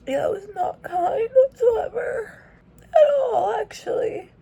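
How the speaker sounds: high, tearful whisper breaking; crying. Real, not acted. Telling viewers she finished the book, through tears. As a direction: fight back tears as you speak.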